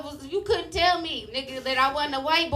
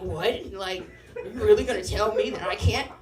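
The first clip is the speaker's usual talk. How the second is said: mock outrage; 4 semitones lower than usual. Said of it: "I'm fucking outraged".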